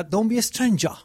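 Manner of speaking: slow, careful mock British English; drawn-out British accent attempt; second British accent try, slowed down. Shown as a demonstration. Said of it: "یا، یا".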